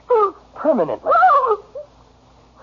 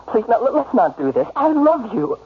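raised, pleading voice; begging; 3 semitones higher than usual. Acted. Husband begs wife to take him back, pleading. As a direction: plead desperately with an estranged wife. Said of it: "Stop begging".

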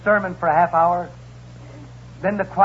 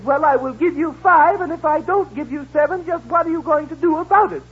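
raised prim lady voice; 10 semitones higher, 3 dB louder than usual. Acted. Customer to porter, a raised fussy lady voice. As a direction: play the fussy rich lady.

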